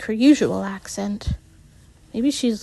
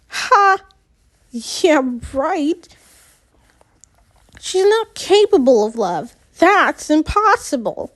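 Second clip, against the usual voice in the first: scornful; 4 semitones higher than usual. Acted. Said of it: "Shapri interjects".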